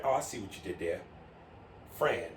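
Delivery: usual speaking voice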